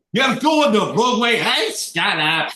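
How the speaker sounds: high, loud Irish squabbling; Irish character voice. Acted. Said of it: "conversation between those two types of voices".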